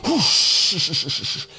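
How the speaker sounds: long, hissed crowd hush; a rush of air trailing into a shush; audience hushing. Acted. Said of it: "the audience might go".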